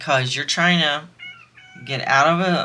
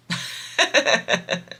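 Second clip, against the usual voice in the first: high, airy chuckle; light burst of high laughter; laughing; 10 semitones higher than usual. Real, not acted. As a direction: chuckle at the silly law. Laughing at a stupid law.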